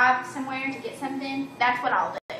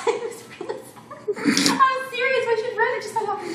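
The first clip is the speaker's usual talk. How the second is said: laughing; 10 semitones higher than usual. Real, not acted. Laughing about a sad cartoon.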